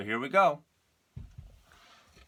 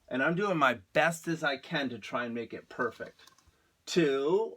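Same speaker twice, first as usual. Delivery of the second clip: ordinary delivery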